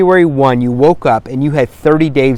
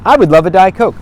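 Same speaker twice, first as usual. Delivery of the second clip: bright, high and a bit louder; cheery role play; 10 semitones higher, 3 dB louder than usual. Shown as a demonstration. Role playing with a spouse, cheerful and high. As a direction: say it like you mean it, upbeat. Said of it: "role play like this with your spouse".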